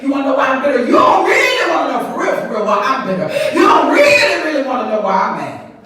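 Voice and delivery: bitter woman shouting at the congregation; mock shout.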